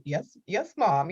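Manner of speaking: put-on small, high, soft child's reply; meek compliance. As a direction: imitate a child quietly giving in to mom. Child giving in to scolding mother.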